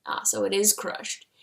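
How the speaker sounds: usual speaking voice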